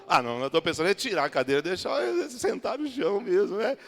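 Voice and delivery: usual speaking voice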